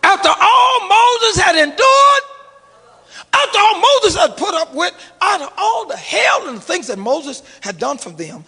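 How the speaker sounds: climbing preacher's shout that eases off; preaching build. Acted. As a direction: lift it, then let it fall.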